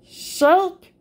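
usual speaking voice

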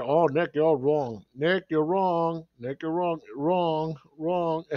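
mocking critics. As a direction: mimic the critics' nagging chant, lightly. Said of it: "All here".

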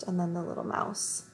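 ordinary delivery

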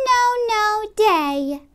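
ordinary delivery

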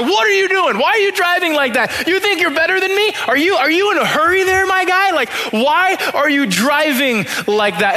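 high, indignant mock outburst at the truck driver; mock road rage. Pastor retells yelling at the passing truck, hammed-up fury. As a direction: yell at the truck that cut you off, hammed up. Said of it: "I just get fuming mad".

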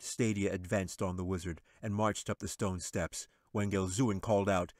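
plain reading voice

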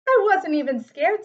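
proud bear insisting he is brave; indignant bear. Acted. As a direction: bristle and deny it loudly. Bear insists he wasn't scared.

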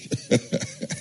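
burst of laughter. Real, not acted. Host laughs at his own story.

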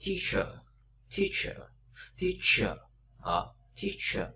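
"teacher" with a long ee and a short er ending; teacher demo. Shown as a demonstration. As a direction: model "teacher" clearly, the last one slow. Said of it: "nó sẽ phát âm rất là mạnh như vậy".

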